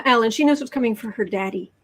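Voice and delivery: ordinary talk, nothing marked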